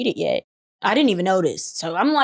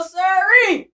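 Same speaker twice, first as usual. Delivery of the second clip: high, loud drawn sirree; mock refusal; 10 semitones higher, 5 dB louder than usual. Refusing the boys, high loud sirree.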